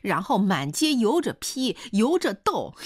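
high-pitched angry woman's tirade in dialect; scolding rant, pitched well above narration. A narrator's reading. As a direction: lash out with escalating scorn. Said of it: "就听胡彩香又乱嚼乱骂起来".

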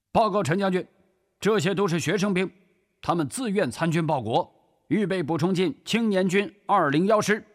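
high, forceful officer's report; military report. Acted. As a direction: report to the general, pitched high and crisp. (军人高声报告)

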